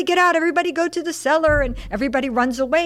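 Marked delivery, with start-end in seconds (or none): 0.0-1.6 high, hurried mock alarm; terrified. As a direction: act out a panicked evacuation order. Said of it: "the waiter's terrified".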